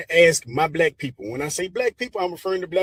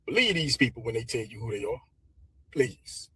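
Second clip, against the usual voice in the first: softer, lower, earnest appeal; soft sincere plea; 5 semitones lower, 8 dB quieter than usual. Real, not acted. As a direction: lean in and plead quietly.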